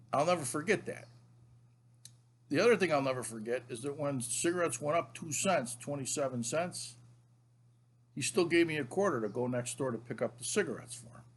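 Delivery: ordinary delivery, nothing marked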